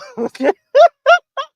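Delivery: loud shrieking laughter; high laugh. Real, not acted. Tickled by her old video, loud high laugh. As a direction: burst out laughing.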